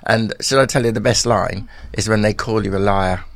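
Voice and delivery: usual speaking voice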